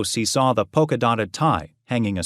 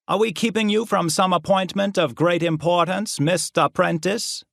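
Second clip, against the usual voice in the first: lightly drawled mock courtesy; mocking professor; 8 semitones higher than usual. A narrator's reading. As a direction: needle him with false politeness. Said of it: "Professor Yokver asked".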